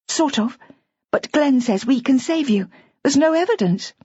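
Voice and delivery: put-on a young wife trying to reassure him; young woman Bridget. A narrator's reading. Bridget voiced by narrator.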